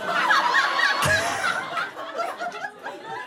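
high breathy cackle of laughter; squealing laugh. Real, not acted. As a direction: burst into giggles. Laughing at own self-talk.

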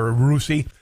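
normal talking voice

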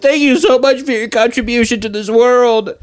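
sangat tinggi, mengejek ucapan terima kasih; overdone falsetto gratitude; gushing high-pitched thanks. Retold or imitated. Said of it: "People are like".

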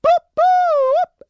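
high loud mock siren whoop; siren imitation. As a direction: belt out a police siren noise.

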